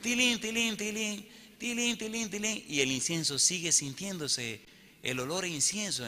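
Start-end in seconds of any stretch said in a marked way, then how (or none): 0.0-2.6 imitated little bells. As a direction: chime lightly like small handbells. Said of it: "empiezan a sonar unas campanitas".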